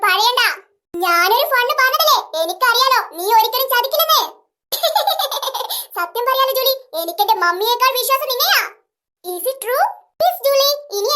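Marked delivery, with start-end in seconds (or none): 4.7-5.9 giggle. Acted. Pleased laughter.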